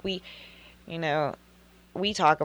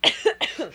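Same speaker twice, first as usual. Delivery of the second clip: sputtering cough on water; choking cough; 10 semitones higher than usual. Real, not acted. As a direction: cough as if water went down wrong. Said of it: "I'm choking on my water".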